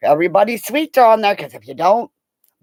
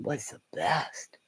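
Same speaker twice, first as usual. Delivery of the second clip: whisper; 5 semitones lower, 14 dB quieter, slower than usual. Real, not acted. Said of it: "whispered aside".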